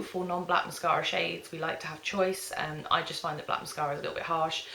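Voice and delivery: normal talking voice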